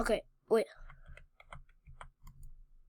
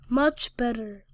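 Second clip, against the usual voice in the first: usual speaking voice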